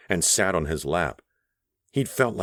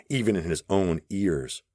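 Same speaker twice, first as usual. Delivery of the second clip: usual speaking voice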